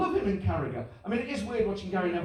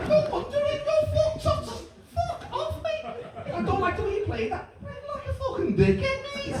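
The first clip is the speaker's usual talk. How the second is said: put-on modern Scouse accent. Paul shouting at John, high modern Scouse rant. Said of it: "how Scousers sound now".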